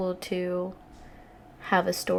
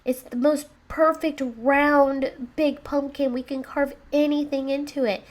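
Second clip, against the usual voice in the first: put-on insistent kid voice, climbing higher; eager child; 4 semitones higher, 4 dB louder than usual. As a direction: build it up like a child pleading with Mommy. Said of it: "he says".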